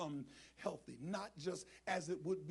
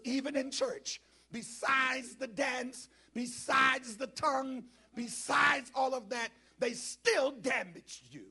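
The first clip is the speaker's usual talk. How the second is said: higher, slow, drawn-out preacher cadence; emphatic preaching; 6 semitones higher, 8 dB louder, slower than usual. Real, not acted. Preacher lamenting church hurt to congregation, slow and emphatic. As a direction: build it phrase by phrase, stretched out.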